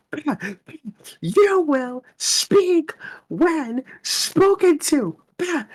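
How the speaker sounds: very high, very slow scold; squeaky slow scolding. Acted. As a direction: squeal each word slowly. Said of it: "I'll be Doctor Lingham".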